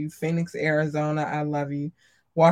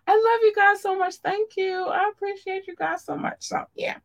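squeaky cute voice. Acted. Cutesy thanks to listeners.

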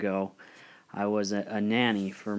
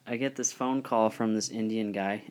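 ordinary delivery

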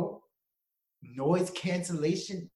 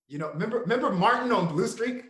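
ordinary talk

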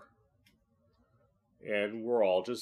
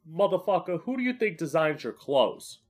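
sassy retort; 8 semitones higher, 4 dB louder than usual. Acted. Retorting to homophobic straight people.

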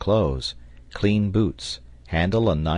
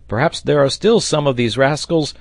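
high, loud caution; warning cry; 7 semitones higher, 6 dB louder than usual. A narrator's reading. Sailor warning the captain of remaining apes, crying out. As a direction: call out the danger urgently. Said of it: "cried Pencroft".

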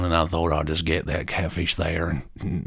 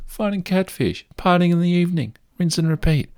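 excited dreaming; 12 semitones higher, 4 dB louder than usual. Real, not acted. Dreaming of catfish afterlife.

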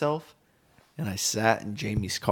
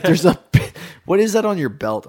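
surprised; 7 semitones higher, 9 dB louder than usual. Retold or imitated. Buddy notices the stain.

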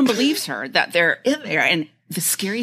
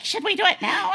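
goblin voice; 12 semitones higher than usual. Retold or imitated. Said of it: "and they're like".

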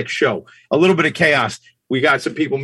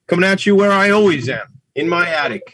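ordinary talk, nothing marked

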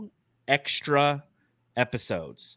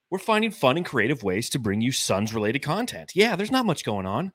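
ordinary talk

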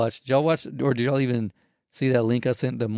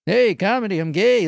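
chipper, higher and louder comic patter; energetic, in-your-face stand-up delivery; Mark Norman; 7 semitones higher, 5 dB louder than usual. Retold or imitated. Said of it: "energetic in your face and shit".

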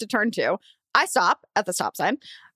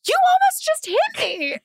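screaming back. Retold or imitated. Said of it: "I just start screaming".

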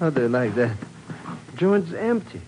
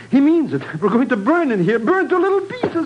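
panic; 7 semitones higher, 3 dB louder than usual. Acted. Panics about burning alive.

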